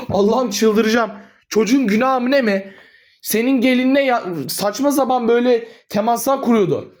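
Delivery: a son yelling at his mother in rage; furious shouting. Acted. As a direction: shout at her like he is losing his mind. Heated quarrel with mother.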